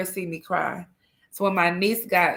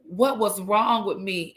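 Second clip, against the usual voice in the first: usual speaking voice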